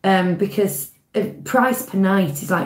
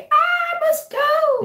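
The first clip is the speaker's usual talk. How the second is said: dramatic fan voice; much slower than usual. Retold or imitated. Chat fans mocked, dramatic.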